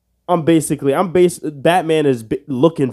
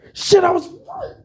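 surprised yelp. Real, not acted. Surprised at being right.

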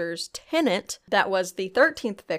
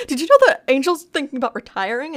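mock frantic gossip; 6 semitones higher, 7 dB louder, faster than usual. Retold coworker spreading gossip.

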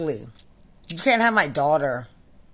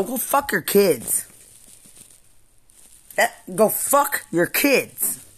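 drawn-out, slow, contemptuous; slow spat insult; 3 semitones higher, slower than usual. Real, not acted. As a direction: drag each word out with disgust. Real furious rant, repeated insult.

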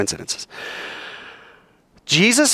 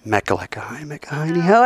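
teacher's whisper; 2 semitones higher than usual. Retold or imitated. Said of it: "your Hebrew roots teacher says".

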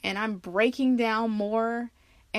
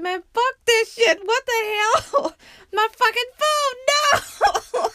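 frustrated yell; 14 semitones higher, 7 dB louder than usual. Retold or imitated. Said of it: "I said".

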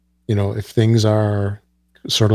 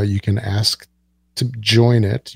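ordinary talk

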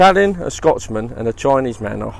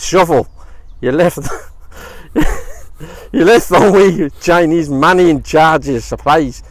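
put-on a light Scottish accent, a bit louder; a jokey Scot making excuses in a Scottish accent; Nicola Sturgeon impression; 4 dB louder than usual. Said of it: "your Nicola Sturgeon impersonation".

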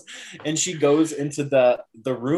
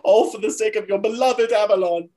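higher, louder, theatrical accusation; accusing Morgaine; 9 semitones higher, 5 dB louder than usual. Retold or imitated. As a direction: hurl it at her like a verdict. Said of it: "She says".

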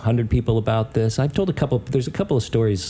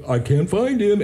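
higher put-on bartender voice; bartender doing voices; 7 semitones higher than usual. Bartender reporting back to the caller, put-on voice. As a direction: imitate a mocking bartender. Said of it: "and doing in voices".

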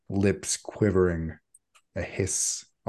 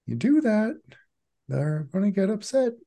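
much higher mild voice; Sun's gentle voice; 12 semitones higher than usual. Acted. Sun scolds Erica from behind in a much higher voice. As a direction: speak as a mild, polite figure.

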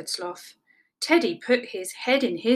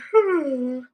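weary sigh; 4 semitones higher than usual. A narrator's reading. Sloth tired.

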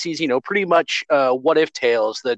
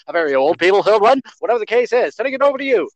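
put-on fast, higher old-time radio announcer; 1950s announcer; 4 semitones higher, faster than usual. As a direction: rattle it off like a newsreel host. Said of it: "nineteen fifties announcer [name]?".

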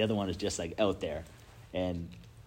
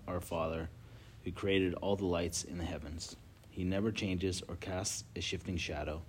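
scripture reading; 3 semitones lower, 4 dB quieter than usual. Acted. Reads scripture aloud.

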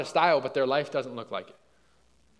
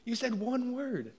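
slow, quiet, stunned; hushed awe; 7 semitones higher, 8 dB quieter, slower than usual. Retold or imitated. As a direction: let it out in slow, hushed disbelief. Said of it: "And I was like".

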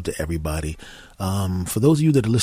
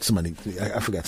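ordinary talk

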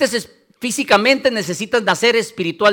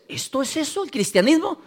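higher, hushed doubting question; mock disbelief; 6 semitones higher, 4 dB quieter than usual. Preacher mimics a believer doubting Christianity, in mock disbelief. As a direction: imitate a let-down believer's doubt.

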